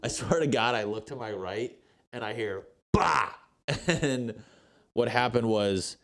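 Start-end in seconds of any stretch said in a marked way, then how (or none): 2.9-3.3 sudden loud, high "Bah!" burst; mock crash noise. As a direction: blurt the crash like a sound effect. Imitating the crash he heard.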